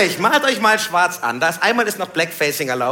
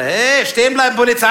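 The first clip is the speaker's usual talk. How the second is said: laut und höher befehlend; Polizeiruf; 6 semitones higher, 6 dB louder than usual. Retold or imitated. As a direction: halt ihn auf wie ein Streifenpolizist. (Polizist ruft laut: stehen bleiben)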